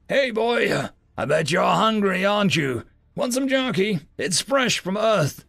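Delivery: soothing. A narrator's reading. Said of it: "Ryan soothed the creature".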